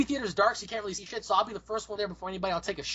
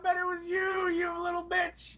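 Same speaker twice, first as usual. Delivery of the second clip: high, hammed-up shouted blame; cranky put-on outrage; mock accusation; 9 semitones higher than usual. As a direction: accuse shrilly, dragging out the insult. Old man blaming someone.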